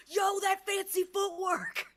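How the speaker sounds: high, loud, stretched-out hype; super excited. Acted. Alivire picks up and shakes Jeremiah, super excited. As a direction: holler it high, drawing it out. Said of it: "Super excited over that fight".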